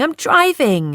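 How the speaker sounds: high, swooping objection; protesting. A narrator's reading. Driver objects to the drink he bought, protesting. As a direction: pitch soaring in protest. Protests at being given brandy.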